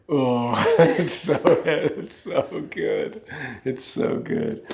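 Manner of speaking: tearful half-laugh dissolving into a whisper; words breaking under tears, slow; crying. Real, not acted. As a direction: overcome with emotion. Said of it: "Sorry, I didn't mean to get emotional".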